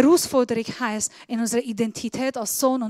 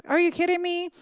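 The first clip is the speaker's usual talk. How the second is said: higher, disbelieving English aside; incredulous; 5 semitones higher than usual. Acted. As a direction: toss it off in disbelief. (ungläubig auf Englisch)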